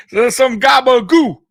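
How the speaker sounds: loud mock New Jersey mobster saying gabagoo; playful New York mobster bit; New York accent. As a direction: lean into a hammed-up New York Italian accent. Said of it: "is that his New York accent over there?".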